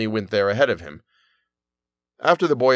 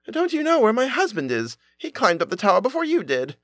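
frightened; 11 semitones higher than usual. A narrator's reading. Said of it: "she became frightened".

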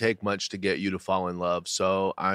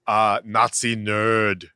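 mock Swedish accent; 4 semitones higher, 3 dB louder than usual. Co-host imitating Ulf in a mock Swedish accent. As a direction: drag out the words in a put-on Swedish accent. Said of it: "I don't know what this accent is".

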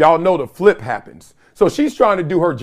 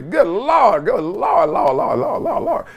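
mock awestruck; 4 semitones higher, slower than usual. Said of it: "In my head, I'm like".

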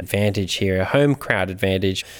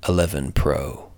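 low, soft, weighty pronouncement; retold farmer; 5 semitones lower, 5 dB quieter than usual. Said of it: "And he said".

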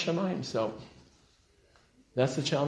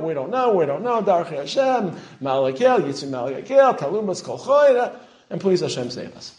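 loud, raised recital of a plea; imitating humble supplication; 6 semitones higher, 7 dB louder than usual. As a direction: quote the letters as an emphatic, pleading declamation. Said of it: "totally different tonality".

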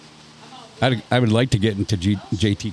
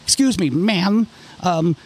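polite grandma question; 7 semitones higher, slower than usual. Retold or imitated.

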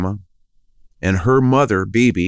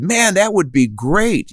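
higher, eager yes; mock-thrilled reply; excited agreement; 9 semitones higher than usual. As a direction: jump at the offer with a lift. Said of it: "And we said".

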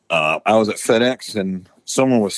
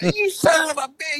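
imitated angry cursing; 3 dB quieter than usual. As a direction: curse like the pranked victim, pitched sky-high. Retold curse from prank victim.

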